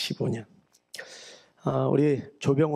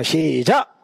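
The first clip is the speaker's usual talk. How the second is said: called cue; 4 dB louder, much slower than usual. Acted. Pastor cues congregation's amens.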